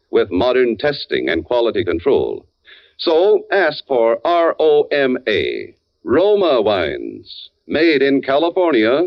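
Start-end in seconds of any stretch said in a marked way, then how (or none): 3.0-7.5 each letter of R O M A set apart, slow; slow, deliberate letters then the name; spelled-out brand name. Acted. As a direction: spell the name out slowly so it sticks.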